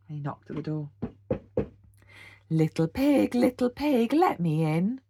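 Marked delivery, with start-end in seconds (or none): 2.5-5.0 bellowing wolf. A narrator's reading. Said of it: "bellowed the wolf".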